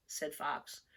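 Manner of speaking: usual speaking voice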